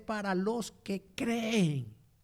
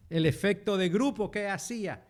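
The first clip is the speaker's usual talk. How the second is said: usual speaking voice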